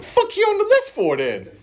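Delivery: high-pitched mock outrage; retold exasperated outburst; exasperated sponsor. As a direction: demand it in a high, incredulous cry. Acted sponsor scolding him.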